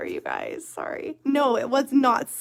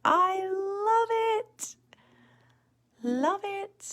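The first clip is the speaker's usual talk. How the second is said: high, drawn-out delighted squeal of love it; high excited squeal; 13 semitones higher, slower than usual. Real, not acted. Admiring her finished nail.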